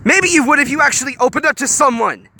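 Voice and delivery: loud, high contemptuous retort; spat. A narrator's reading. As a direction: spit the taunt at her. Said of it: "Honey spat".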